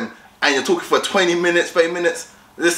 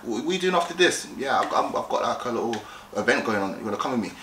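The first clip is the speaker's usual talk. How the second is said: soft, low-key invitation; calm and collected; 4 semitones lower, 7 dB quieter, faster than usual. Shown as a demonstration. Guy asking a girl out after this, calm and collected. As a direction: ease off and drop to a casual murmur. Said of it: "Be calm and collective".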